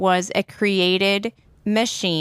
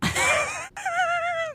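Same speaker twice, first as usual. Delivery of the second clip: high laugh; 6 dB quieter than usual. Real, not acted. Laughing at the robot voice.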